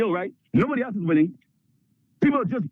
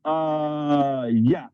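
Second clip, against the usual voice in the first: awkward dismay; 7 semitones lower than usual. Retold or imitated. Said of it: "And you go".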